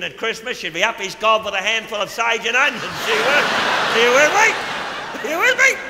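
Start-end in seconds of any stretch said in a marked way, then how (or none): 3.1-5.8 climbing higher each time; building pleading higher; rising audience check. Acted. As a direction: push the crowd for a reaction. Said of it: "repeated audience check, rising".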